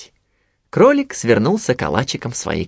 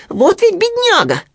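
very high piglet voice, exclaiming; squeaky little piglet; 4 dB louder than usual. A narrator's reading. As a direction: pipe it up high in dismay. (писклявый голос поросёнка)

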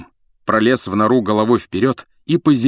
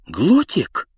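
stunned exclamation; 6 semitones higher than usual. Acted. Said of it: "ошарашенно воскликнула Гермиона".

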